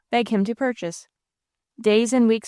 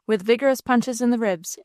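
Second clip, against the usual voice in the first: ordinary delivery, nothing marked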